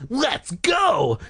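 high, urgent shout to friends. A narrator's reading. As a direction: call out to get everyone running. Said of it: "Greg shouted to Bird and Michael".

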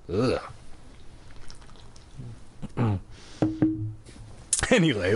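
plain reading voice

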